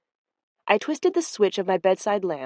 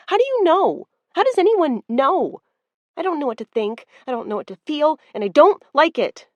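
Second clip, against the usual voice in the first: high, louder panicked questions; worked-up friend, much higher; 8 semitones higher, 5 dB louder than usual. Acted. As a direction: spiral into panic. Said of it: "Misty had done a fine job of working herself up".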